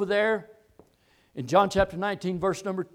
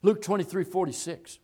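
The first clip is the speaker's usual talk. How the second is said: usual speaking voice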